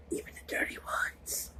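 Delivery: sly conspiratorial whisper. Retold or imitated. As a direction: whisper it like a secret. Friend's sly tip, retold.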